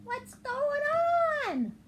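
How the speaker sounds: high, loud confused cry; confused Jim. Acted. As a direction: squeak it out in bewilderment. Confused Jim's outburst.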